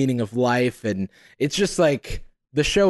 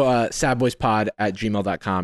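normal talking voice

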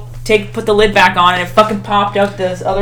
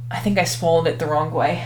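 ordinary talk

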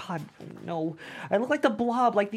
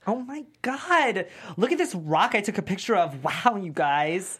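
mock gushing; 3 semitones higher, 4 dB louder than usual. Teasing the dancer, mock gushing over a rock. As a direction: ham up fake amazement.

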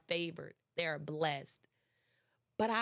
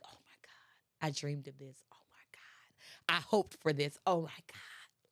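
awed whisper; 6 semitones lower, 4 dB quieter than usual. Pretend. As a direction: hush it out, amazed the dream came true. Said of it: "You're gonna really like".

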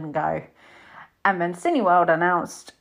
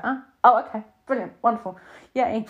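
mock enthusiasm; 4 semitones higher than usual. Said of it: "It's just like".

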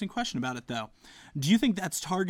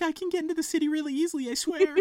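mock eager promise; 12 semitones higher, faster than usual. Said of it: "You're like".

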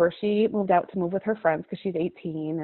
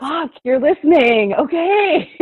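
high, loud surprised exclamation; retold astonishment, pitched way up; amazed reaction; 7 semitones higher, 9 dB louder, slower than usual. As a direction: burst out in delighted disbelief.

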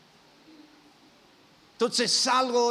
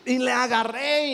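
normal talking voice

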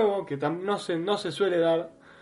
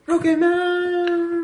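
stretched out, much higher pitch; drawn-out high; 12 semitones higher, 3 dB louder, much slower than usual. Shown as a demonstration. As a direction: sing the title slowly up high. Repeats title drawn out.

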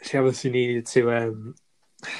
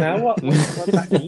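baffled; 5 semitones higher, 4 dB louder than usual. Retold or imitated. Baffled by doctor leaving.

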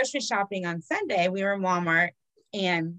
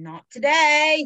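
loud slow catchphrase; 5 semitones higher, 6 dB louder, slower than usual. Acted. Repeated catchphrase louder.

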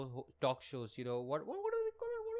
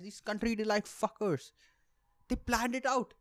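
usual speaking voice

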